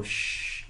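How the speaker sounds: soft voiceless "shh", demonstrated; quiet hushing sound; shushing. Retold or imitated. Costumed man hushing onlookers during filming, soft shush. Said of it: "hold up my fingers to my helmet and go".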